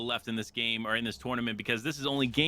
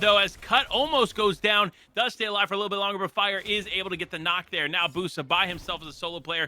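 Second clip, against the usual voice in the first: fast, loud, high hype call; esports caster; 6 semitones higher, 4 dB louder than usual. Acted. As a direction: call the fight at full hype. Hyped play-by-play of final fight.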